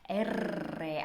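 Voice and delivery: the letter name "R" with a long rolled trill; strong R. Shown as a demonstration. Teacher demonstrating the sound for students, rolled R. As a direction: hold the trill in "R".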